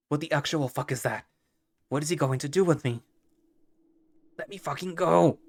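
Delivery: rising panic into a shout; frightened, then yelling to be released; panicked captive. Acted.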